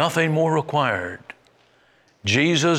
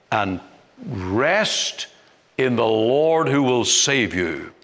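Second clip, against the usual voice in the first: normal talking voice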